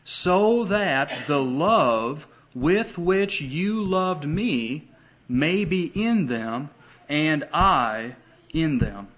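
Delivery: slow, lifted, deliberate reading; slow scripture reading. Acted. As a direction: draw each word out slowly. Reading the verse aloud.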